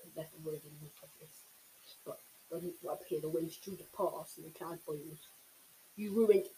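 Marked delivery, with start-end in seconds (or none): 6.0-6.5 loud, raw howl of rage; villain howls at the boy who ruined his plan. A narrator's reading. Howl it out in fury. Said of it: "Cyril howled".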